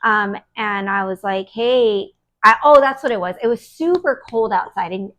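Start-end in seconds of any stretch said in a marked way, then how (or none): none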